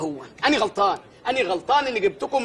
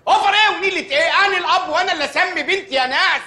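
raised, higher voice of an outraged father; indignant outburst; 6 semitones higher, 3 dB louder than usual. Acted. Father protests to the doctor about his daughter's name, raised indignant voice. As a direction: protest loudly that you name your own child.

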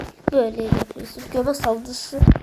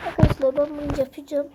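usual speaking voice

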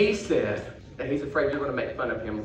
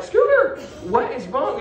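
Scooter's name cried out high and loud, then worried; alarmed concern; 9 semitones higher, 5 dB louder, slower than usual. Retold or imitated. Ventriloquist retells asking the upset puppet what happened, alarmed. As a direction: call out with sudden worry.

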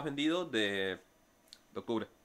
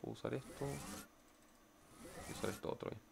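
muttering under breath; 15 dB quieter than usual. Real, not acted. Muttering to himself mid-game.

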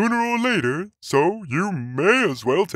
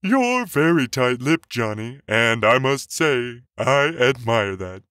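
louder priest voice with grudging admiration; admiration and disappointment; 4 dB louder than usual. A narrator's reading. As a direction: concede respect with a touch of disappointment. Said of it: "a mixture of admiration and disappointment".

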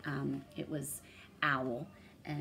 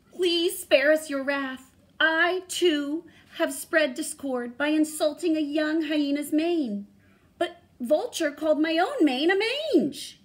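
begging lion; 12 semitones higher, 9 dB louder than usual. A narrator's reading. Lion begs the ghost to spare them. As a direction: beg the spirit for mercy.

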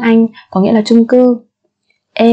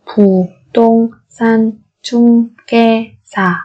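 syllable by syllable. Shown as a demonstration. Teacher models word syllable by syllable.

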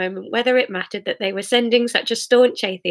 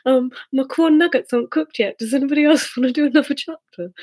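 higher-pitched mock dad grumble; hammed-up dad whine, breaking into a laugh; 6 semitones higher than usual. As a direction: put on a complaining dad. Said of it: "I feel like a dad".

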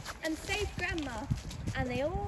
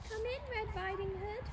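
Grandma's voice; 7 semitones higher, 9 dB quieter than usual. Acted. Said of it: "said the wolf in Grandma's voice".

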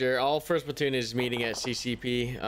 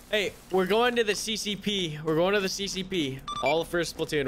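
shouting; 7 semitones higher than usual. Real, not acted. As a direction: call it out over gunfire. Calling squad to regroup.

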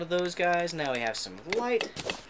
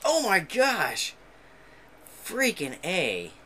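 high, slow exasperated cry; frustrated outburst; 5 semitones higher, slower than usual. Real, not acted. Gamer just killed in the game, exasperated and slow. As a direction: groan at a sudden death.

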